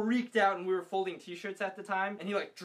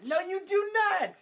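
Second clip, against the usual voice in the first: very high, loud mock disbelief; outraged contradiction; 14 semitones higher, 7 dB louder than usual. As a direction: squawk the contradiction loudly. Friend contradicts the boast.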